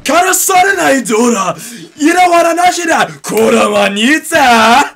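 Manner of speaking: high, loud gibberish Japanese shout; fake Japanese. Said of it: "my perfection of fake Japanese".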